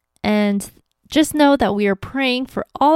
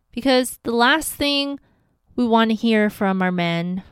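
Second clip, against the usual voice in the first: ordinary talk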